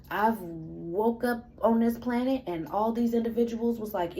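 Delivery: ordinary talk, nothing marked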